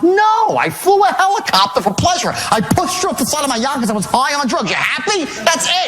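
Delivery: high, exasperated retold shout; pissed-off outburst. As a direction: blow up at a nosy caller with sarcastic confessions. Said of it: "I'm getting pissed off".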